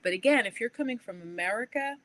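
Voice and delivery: ordinary talk, nothing marked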